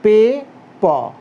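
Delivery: very slow पे then प, each drawn out; slow recap. Shown as a demonstration. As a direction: draw out पे and प slowly for the class. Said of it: "बे, ब".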